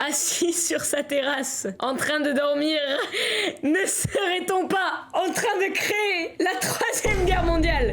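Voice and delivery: slow, words squeezed through laughing; helpless laughter. Real, not acted. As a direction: narrate while cracking up. Cracking up over his scene. (mort de rire en parlant)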